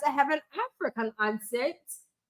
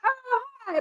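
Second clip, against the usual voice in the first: bright high-pitched greeting, pitched way up; excited hi; 10 semitones higher, 4 dB quieter than usual. Pretend. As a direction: greet Professor Tot with a jump in pitch. Greeting her own persona.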